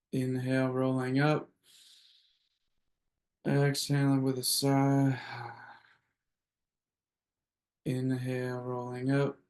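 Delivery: soft, sparse breath cues with long pauses; guided breathing. Acted. Host leading the group's breaths, soft guided breathing. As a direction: count them gently through each breath, leaving space.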